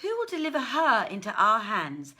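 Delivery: usual speaking voice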